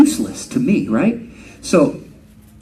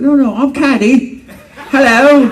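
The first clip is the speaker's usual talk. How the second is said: higher, louder, hammed-up British greeting; posh British introduction; 10 semitones higher, 5 dB louder than usual. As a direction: play the stuck-up Brit announcing himself. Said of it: "He said".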